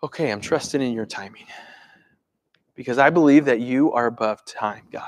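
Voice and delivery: low, settled prayer; calm after deep breath. Acted. Believer pausing to trust God's timing, calm. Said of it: "pause, take a deep breath and go".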